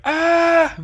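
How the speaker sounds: sudden high yelp to spook; mock scare shout. Grandfather spooking a leaving kid, sudden high yelp. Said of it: "they're like".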